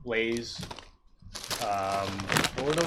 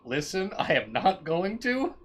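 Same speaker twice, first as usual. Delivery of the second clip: higher, slow, emphatic declaration; imitating a man firmly refusing; adamant; 7 semitones higher, 3 dB louder, slower than usual. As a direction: insist on it, word by deliberate word. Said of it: "he was adamant".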